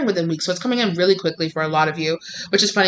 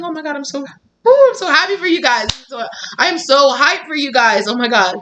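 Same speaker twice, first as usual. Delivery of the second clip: high, loud squeals of joy for the viewers; bursting with happiness, pitch way up; real excitement; 7 semitones higher, 3 dB louder than usual. Real, not acted. Reader overjoyed at the cards for her viewers, squealing excitedly.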